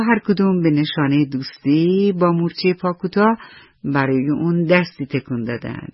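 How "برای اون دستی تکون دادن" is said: usual speaking voice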